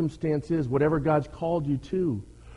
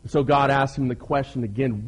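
ordinary talk

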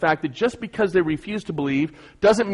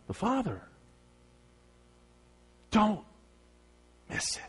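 hushed; 6 semitones higher, 11 dB quieter than usual. Acted. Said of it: "drops to near silence after peak".

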